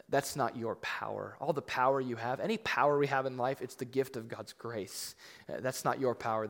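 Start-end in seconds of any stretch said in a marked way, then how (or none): none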